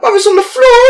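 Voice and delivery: retold impatient answer; exasperated retort. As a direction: fling it out high.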